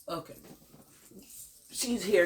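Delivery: usual speaking voice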